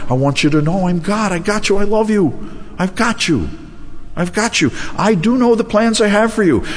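high, tender voice of God; God reassuring. Retold or imitated. Voices God reassuring his people.

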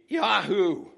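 high, loud cowboy-style whoop; overjoyed holler; joyous astonishment. Acted. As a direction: whoop it out in delighted amazement. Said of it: "expressive of joyous astonishment".

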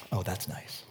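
very soft, low polite murmur; understated praise, barely voiced; prim, restrained. Prim churchgoers watching a baptism, hushed and restrained. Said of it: "Baptist prim and proper church".

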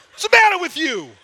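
high, loud shouting rebuke; drill sergeant self-talk. Shown as a demonstration. Preacher showing how to yell at yourself, loud. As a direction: bellow at your own whining. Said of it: "You ought to talk to yourself that way".

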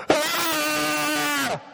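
loud, high, sustained mock bawl; one long wailing "aah" like a bawling kid; child's bawling wail. Shown as a demonstration. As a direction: imitate a child crying out loud. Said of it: "heard a child do this kind of crying".

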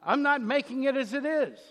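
imitating an outraged crowd; incredulous protest. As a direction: scoff like folks who can't afford to give. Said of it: "You can hear them go".

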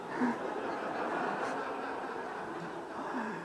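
long falling laugh; audience-style laugh. Real, not acted. Laughing at own story.

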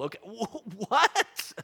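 ordinary delivery

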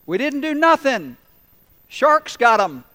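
high, loud shouted reply; shouting back. Acted. Local on the shore shouting to the swimmer, offhand. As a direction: holler it out to the swimmer.

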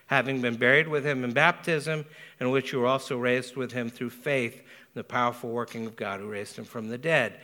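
ordinary talk, nothing marked

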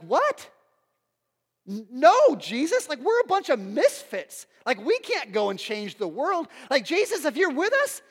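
loud, high, swooping disbelief; acted-out alarm, pitch leaping up on key words; incredulous protest. Disciples protesting to Jesus that he can't leave, incredulous. Preacher acts out shocked disciples.

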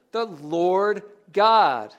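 "Lord" and "God" each slow and weighted; emphasis on the name. Shown as a demonstration. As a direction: spell out the point, stretching "Lord God". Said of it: "What's the emphasis?".

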